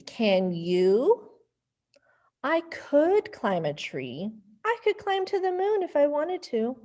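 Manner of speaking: put-on lively storybook voices for kids; reading to children. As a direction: read it playfully to little ones. Reading picture book to kids.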